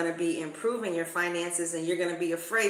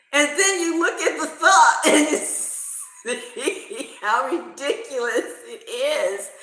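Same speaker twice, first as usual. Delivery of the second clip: slow, laughing through the words; 5 semitones higher, 6 dB louder, slower than usual. Real, not acted. Teller telling viewers to laugh at fears, slow and laughing. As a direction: giggle as you say it. Laughing about ridiculous thought.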